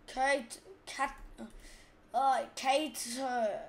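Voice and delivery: American accent, cater slow with a pushed t; exaggerated American cater in separate syllables; attempted American accent. Shown as a demonstration. Gamer imitating Americans for contrast, American accent with hard t. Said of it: "if I was American, I'd say it like this".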